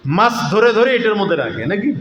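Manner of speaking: ordinary delivery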